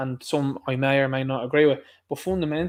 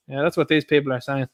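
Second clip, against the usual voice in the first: ordinary talk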